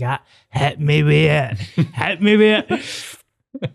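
mock dare through laughter; laughing taunt. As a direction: goad him while cracking up. Retold goading, laughing.

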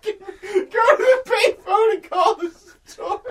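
high, slow, shouted through laughter; can barely get it out for laughing. Retold or imitated. Retelling a joke while cracking up.

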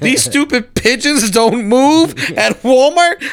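slow, loud mock rant. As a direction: vent it out, drawn out. Venting rant on the show.

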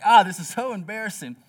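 half-hearted cringe at yourself; mock embarrassed groan. Dad on the flight, cringing at screaming kids. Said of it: "I'm just like".

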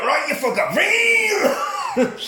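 high, slow hammed-up shout; mock battle cry. Retelling the heroine grabbing a chainsaw, high hammed-up shout. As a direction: roar it out like an action hero. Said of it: "she's like".

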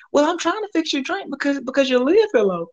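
high put-on woman's voice; mock worker answering the customer; imitated defensive worker. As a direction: explain it back a bit indignant.

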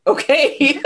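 okay squeezed out through laughter; amused disbelief, laughing it off; high giggly okay. Real, not acted. Laughing at son's brush-off.

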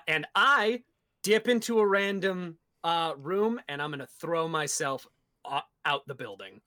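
ordinary talk, nothing marked

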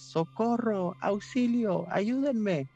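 high desperate cries for help; calling for help. Acted. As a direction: scream for help from a nightmare. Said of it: "comenzó a gritar con todas sus fuerzas".

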